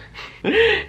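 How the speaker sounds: a quick high burst of laughter; short high laugh. Real, not acted. Laughing at his own story.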